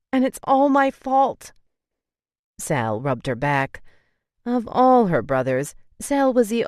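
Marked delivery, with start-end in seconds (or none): 0.1-1.5 higher and slow, tearful self-blame; crying. Acted. Leaning on her brother, crying over her fault. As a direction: let the words crack as she cries. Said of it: "She leaned against Sal and cried".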